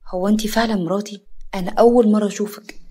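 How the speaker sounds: usual speaking voice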